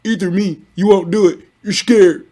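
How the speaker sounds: high taunting imitation of hecklers, singsong; mocking the channel idiots. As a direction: sneer it like a begging troll. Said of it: "idiots who don't have no views on the channels who keep poking at me".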